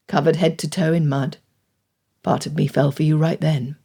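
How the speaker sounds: low tender murmur; deep male voice. A narrator's reading. Fitzwilliam speaks tenderly.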